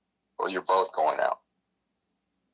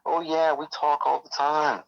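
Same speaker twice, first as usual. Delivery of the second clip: put-on girl voice; 6 semitones higher, slower than usual. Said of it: "And she's like".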